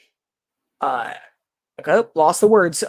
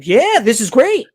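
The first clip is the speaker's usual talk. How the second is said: mildly excited, higher-pitched cheer; proud; 4 semitones higher than usual. Retold or imitated. Imagining people proud of the brand, mildly enthusiastic. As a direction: voice a touch of proud delight. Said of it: "people proud and being like".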